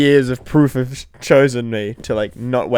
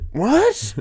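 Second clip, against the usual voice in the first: confused what; 13 semitones higher than usual. Retold or imitated. Just woken, confused.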